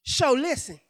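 normal talking voice

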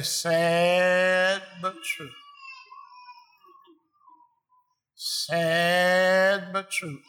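very slow, drawn-out murmur; mournful agreement. Retold or imitated. Front-row churchgoer answering the preacher, slow drawl. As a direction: draw every word out, heavy-hearted. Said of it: "he'll say".

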